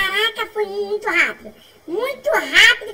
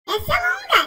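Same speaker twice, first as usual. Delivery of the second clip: laughing; 11 semitones higher than usual. Real, not acted. Repeats with amusement.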